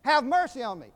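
loud, high preacher's cry for mercy; retold blind beggar's shouted plea. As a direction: call out urgently over a crowd. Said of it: "He began to cry out".

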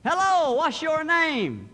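high, booming phone voice, slow; loud telemarketer. Acted. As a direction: call out loudly down the phone to a child. Telemarketer calling a whispering child.

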